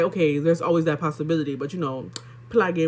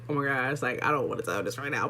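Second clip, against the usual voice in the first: retold nervous dread; 5 semitones lower, 6 dB quieter than usual. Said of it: "Like, I'm just scared".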